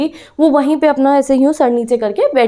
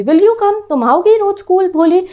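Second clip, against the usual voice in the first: hopeful coaxing; 6 semitones higher than usual. Retold or imitated. Teacher eagerly coaxing Bholi.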